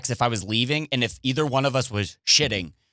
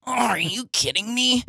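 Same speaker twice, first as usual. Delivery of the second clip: exasperated whine; 10 semitones higher than usual. Retold or imitated. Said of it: "I go".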